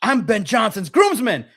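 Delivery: drilling "groomsman" into the interviewers; mock shouting. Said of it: "should have screamed Ben Johnson's name".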